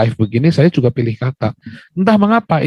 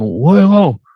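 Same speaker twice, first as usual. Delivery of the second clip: mock insult; 6 semitones higher, 3 dB louder, slower than usual. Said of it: "nggak boleh saya ngomongin begitu".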